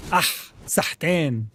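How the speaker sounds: "sahtain" said at x0.4, slowly; Arabic pronunciation. Shown as a demonstration. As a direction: spell out "sahtain" slowly for learners. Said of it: "really hard for most English speakers".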